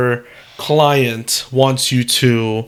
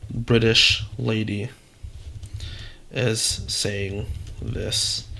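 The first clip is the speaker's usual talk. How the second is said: slow dictation; 6 dB quieter, much slower than usual. Real, not acted. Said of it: "dictating prompt while typing".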